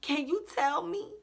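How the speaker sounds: mock pleading. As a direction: beg them for answers in mock desperation. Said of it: "And I'm just like".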